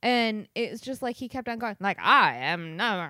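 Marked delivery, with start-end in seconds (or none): 2.0-3.1 louder mock transatlantic accent; Katharine Hepburn transatlantic imitation. Co-host mocking Joe Pesci's wavering accent, mock transatlantic. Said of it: "trying to sound like Katharine Hepburn".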